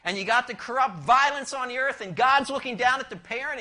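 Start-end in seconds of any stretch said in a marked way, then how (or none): none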